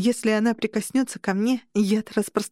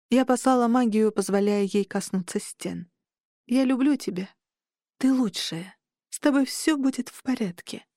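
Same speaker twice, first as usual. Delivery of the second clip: tender farewell. A narrator's reading. Dina farewell to her inn.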